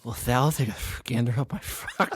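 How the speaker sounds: put-on storybook character voice; Uncle Rumpel impression. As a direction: ham it up as an odd little character. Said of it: "You gonna try yours?".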